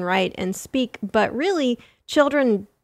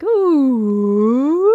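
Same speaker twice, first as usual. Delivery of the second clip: higher, louder imitation of a whale song "Ooh"; whale song moan; 4 semitones higher, 5 dB louder than usual. Shown as a demonstration. Explaining whale song to cohost, imitated whale moan. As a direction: croon "Ooh" like a singing whale. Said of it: "the sort of like".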